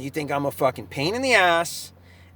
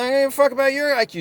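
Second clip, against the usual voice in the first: fast, higher mock retort; 8 semitones higher, much faster than usual. Quoting his own comeback to the doctor, fast and mocking. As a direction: fire it back quick and scornful. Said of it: "I'm like".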